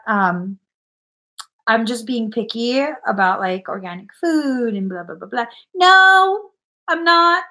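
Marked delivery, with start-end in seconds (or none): 5.8-7.4 emphatic no. Real, not acted. Retorting to critics.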